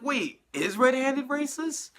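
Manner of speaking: suddenly doubting a common phrase; mock surprised realization. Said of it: "thinking like".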